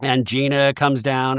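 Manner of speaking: normal talking voice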